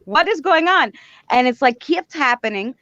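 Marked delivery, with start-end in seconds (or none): 0.1-0.9 What is going on? asked much higher, panicky; frantic disbelief, much higher; panicked question. Retold or imitated. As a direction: pitch it up in alarm. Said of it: "I didn't know what was going on".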